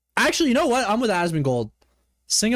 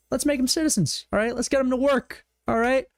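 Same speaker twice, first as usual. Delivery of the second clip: ordinary talk, nothing marked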